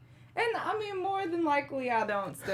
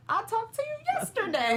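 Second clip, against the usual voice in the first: high-pitched comic protest; mock exasperation; 12 semitones higher than usual. Telling someone who calls too often, high mock exasperation. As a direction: pitch it way up, putting off a clingy caller.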